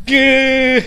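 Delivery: loud high startled cry; startled yelp. Real, not acted. Ghost hunter jolted by the lights, startled yelp. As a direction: yelp at the sudden jump scare.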